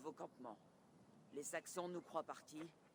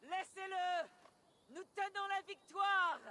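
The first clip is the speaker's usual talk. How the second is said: high, ringing victory shout; battlefield shout; 13 semitones higher, 4 dB louder, slower than usual. Acted. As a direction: call the warriors off from a distance. Leader calling to warriors in battle. (cri de victoire aigu)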